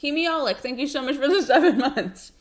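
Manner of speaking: talking through a mouthful; words round sticky peanut butter; mouth full of peanut butter. Real, not acted. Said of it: "when you put peanut butter in your mouth".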